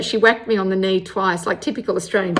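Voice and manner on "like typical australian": plain reading voice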